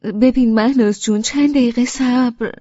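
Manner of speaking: urgent hold-on plea; hurried. Acted.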